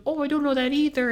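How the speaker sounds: high, quick old-lady imitation. Old lady admitting she doesn't know, high quick imitated voice. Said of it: "She goes".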